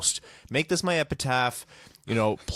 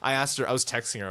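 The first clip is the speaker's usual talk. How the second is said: ordinary talk, nothing marked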